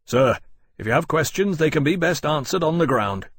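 a little cold. A narrator's reading. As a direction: put him off politely but coolly. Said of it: "a little coldly".

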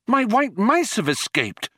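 high, urgent cry of alarm. Acted. Trillian bursts in about her escaped pets, alarmed.

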